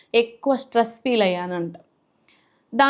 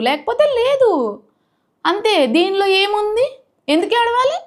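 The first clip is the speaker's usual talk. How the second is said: much higher, defiant and indignant; defiant outburst; 9 semitones higher than usual. Real, not acted. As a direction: fling it back at the trolls, pitch way up. Defiant rant, refusing to cry. (ధిక్కారంగా, చాలా ఎత్తైన స్వరంతో)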